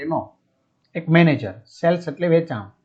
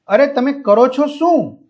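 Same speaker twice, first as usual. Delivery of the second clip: high, exasperated demand, lightly acted; retold angry yell, pitched up; mock shout; 7 semitones higher than usual. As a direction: challenge the guide like an irate customer. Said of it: "મોટે અવાજે બરાડા પાડી રહ્યો હતો".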